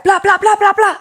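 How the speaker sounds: high, loud gibberish rant; mock TV character. Describing a TV character to the waitress, mock rant. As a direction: ham up the gun-toting lady from the show. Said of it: "She'd be like".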